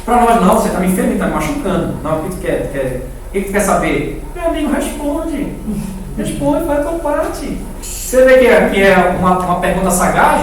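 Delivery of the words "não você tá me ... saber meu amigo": ordinary talk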